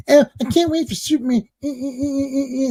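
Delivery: high mock nerd whine, lightly voiced; nerdy fan voice. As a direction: squeak it out like a gushing nerd. Mocking a nerd at the mic.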